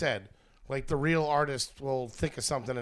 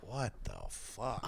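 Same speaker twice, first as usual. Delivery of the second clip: slow hushed disbelief; 6 semitones lower, 13 dB quieter, much slower than usual. Retold or imitated. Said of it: "you're like".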